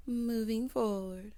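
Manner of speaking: drawn-out moving forward; slow drawn segue. Real, not acted. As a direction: announce the transition slowly. Same segue catchphrase.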